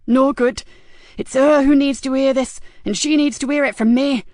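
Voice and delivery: high, loud, urgent female voice; agitated caller refusing to be put off; insistent woman caller. A narrator's reading. Frankie insists on the phone.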